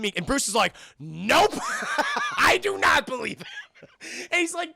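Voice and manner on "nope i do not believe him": high, loud, breaking into laughter; mock stubbornness cracking up; laughing stubborn refusal. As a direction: refuse flatly through your own laughter. Laughs while voicing stubborn Bruce.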